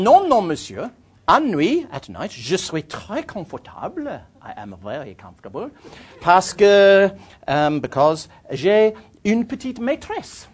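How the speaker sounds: put-on high, loud English-accented French, very pleased with itself; proud schoolboy French. Telling the Frenchman about his mattress, proud schoolboy French. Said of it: "in my best schoolboy French".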